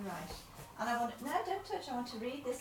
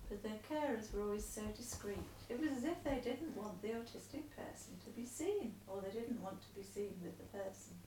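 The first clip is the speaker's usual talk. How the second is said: letter read aloud. Acted. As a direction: read the letter out steadily to him.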